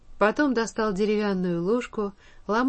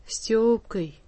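ordinary delivery

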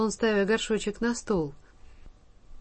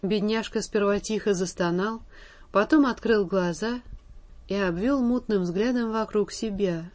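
usual speaking voice

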